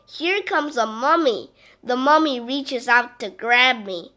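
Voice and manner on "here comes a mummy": ordinary delivery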